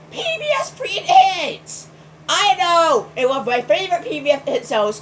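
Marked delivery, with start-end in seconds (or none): 0.1-1.9 incredulous outburst. Real, not acted. Disbelief at a show's name.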